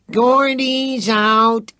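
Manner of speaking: high, slow singsong taunt; gleeful card call. A narrator's reading. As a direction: drag it out mockingly, up high. Card game, teasing call.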